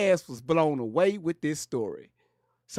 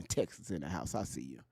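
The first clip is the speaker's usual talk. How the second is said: ordinary delivery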